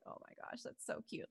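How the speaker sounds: whispered gushing. Retold or imitated. Retelling her own reaction to the cohost, in a whisper. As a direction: breathe it out, charmed.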